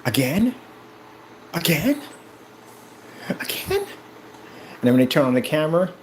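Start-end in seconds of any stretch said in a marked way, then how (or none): 0.1-3.8 mock disbelief. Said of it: "they be getting tickled. Like".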